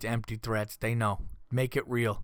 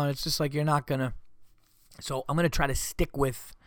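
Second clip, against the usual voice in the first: ordinary talk, nothing marked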